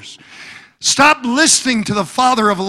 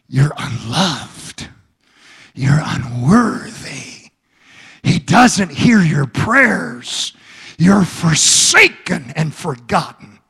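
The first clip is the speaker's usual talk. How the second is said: much lower, slow, menacing murmur; Satan whispering; 5 semitones lower than usual. Acted. Preacher voicing Satan to the congregation, low menacing whisper. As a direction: hiss the lies like a tempter in the ear. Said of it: "Satan whispers in your ear".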